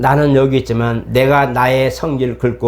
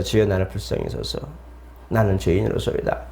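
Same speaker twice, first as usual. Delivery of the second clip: softer, lowly plea to God; a sinner's soft murmured prayer; hushed humble prayer; 4 semitones lower, 6 dB quieter than usual. Retold or imitated. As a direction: pray it quietly like a tax collector. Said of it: "세리가 기도하면서".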